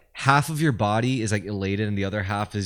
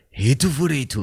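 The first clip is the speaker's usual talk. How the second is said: mock Japanese accent, raised pitch; 5 semitones higher than usual. Shown as a demonstration. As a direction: imitate Japanese-accented English. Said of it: "in Japanese".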